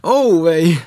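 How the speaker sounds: loud high yelp; cry of pain. A narrator's reading. Pooh being pulled from the hole, yelping loudly. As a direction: yelp out as they pull. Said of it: "a zase".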